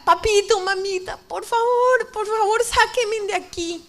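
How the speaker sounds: high-pitched call to parents; urgent, imploring wail of a call; reenacted pleading cry. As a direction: beg mother and father for rescue, slowing at the end. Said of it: "Yo empecé a gritar".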